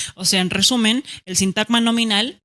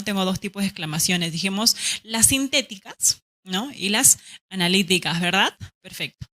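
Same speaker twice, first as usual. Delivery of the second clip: usual speaking voice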